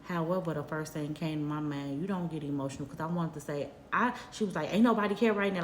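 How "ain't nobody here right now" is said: curt, dismissive tone, a bit louder; imitating a nasty clerk's brush-off; rude brush-off. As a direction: toss it off rudely like a careless clerk. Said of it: "that's how she was talking to me".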